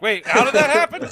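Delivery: high, loud, puzzled laughing voice; confused surprise. Retold or imitated. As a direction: sound baffled and amused.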